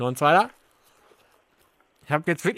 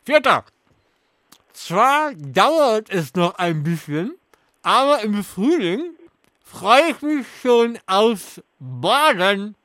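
talking through a mouthful of marshmallows; slow, stuffed-cheek speech; mouth full; 4 semitones higher, slower than usual. Real, not acted. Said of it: "mit vollem Mund alle Sätze vor".

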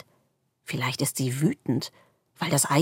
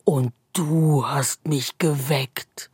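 darkness growling; much slower than usual. A narrator's reading. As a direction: grumble like a woken beast. Darkness voice continues.

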